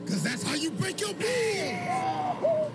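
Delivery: building to a high-pitched shout; villain's shouted taunt. Acted. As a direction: yell it as a gleeful finishing blow. Villain's taunt while breaking bones.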